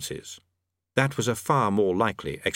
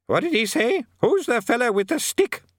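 put-on old wizard; 12 semitones higher than usual. A narrator's reading. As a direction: shout it like a deaf old man.

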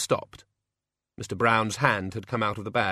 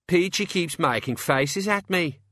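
high, slow whine; petulant playground grievance; childish tattling; 8 semitones higher than usual. Acted. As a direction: complain like a kid telling tales. Childish tattling in gang squabble.

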